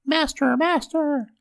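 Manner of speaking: very high piping cry; harp crying out. A narrator's reading. Stolen harp crying for the ogre, high piping cry. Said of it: "the harp cried out".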